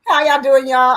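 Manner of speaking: big, loud hello to the audience; loud greeting. Real, not acted. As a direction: call out the greeting with energy. Greeting co-host loudly, country style.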